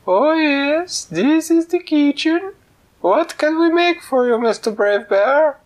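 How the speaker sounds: very high, loud and slow squeaky cook; sing-song it like a tiny cook. Acted. Kitchen cook on phone.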